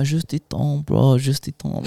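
imitated French speakers, drawn out; mock full-French delivery; French accent. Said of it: "like, full French".

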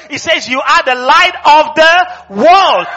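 slow, high, loud preached shout; shouted proclamation. Shown as a demonstration. Preacher repeating the verse because they didn't hear, shouting. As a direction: hammer the verse home at full voice. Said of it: "you didn't hear me".

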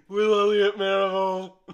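hammed-up Hull impression, belted out; Hull accent. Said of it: "not condoning that impression".

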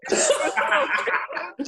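high-pitched breathless laugh; high breathy laugh. Real, not acted. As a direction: burst into a squeaky laugh. Laughing at him moving away.

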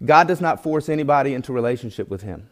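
ordinary talk

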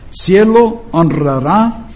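usual speaking voice

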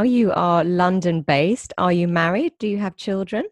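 ordinary talk, nothing marked

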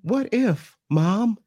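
high-pitched mock daughter voice; tentative daughter. Daughter sounding out her mom about a baby, tentative. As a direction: float it up high, as a nervous daughter would. Acts out daughter testing the idea.

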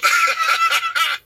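a high burst of giggling; high giggle. Real, not acted. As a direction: break into a squeaky laugh. Giggle at odd sports list.

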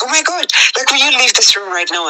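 high, sharp mock yell at her toddler; retold shout. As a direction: shout the order up high, as she once did. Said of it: "I just shouted".

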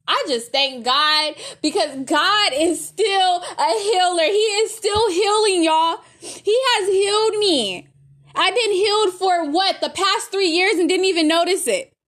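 high-pitched, heartfelt praise to God; fervent testimony. Real, not acted. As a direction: testify with swelling, tearful conviction. Fervent testimony of being healed.

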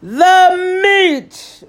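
loud, high roaring demand; whole crew roaring for meat; pirates roaring. Acted. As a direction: roar it like hungry pirates. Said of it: "we all roared".

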